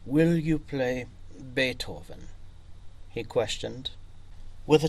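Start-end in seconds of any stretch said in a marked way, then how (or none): none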